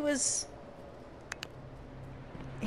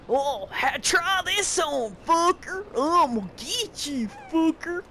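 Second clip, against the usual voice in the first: mock a lazy villain's fight taunts; generic bandit taunt; 6 semitones higher than usual. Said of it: "ten seconds later".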